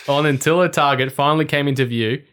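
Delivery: ordinary delivery, nothing marked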